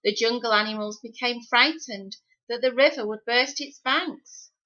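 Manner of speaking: ordinary delivery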